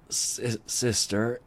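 thin, faltering, weak reedy voice. Acted. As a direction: barely get the word out, reedy. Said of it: "came the reedy voice".

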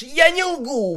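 high, loud sudden blurt. A narrator's reading. Captive blurting his denial to Vinyl, high loud blurt. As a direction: fire it out fast and high. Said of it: "выпалил он".

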